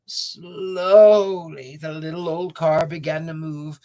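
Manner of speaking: slow drawn-out narration. A narrator's reading. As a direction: stretch it slowly.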